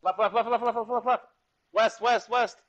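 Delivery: urgent high-pitched yelled callouts, rapid repeats; yelling. Real, not acted. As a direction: yell the directions to your squad in a rush. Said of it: "Sorry to yell".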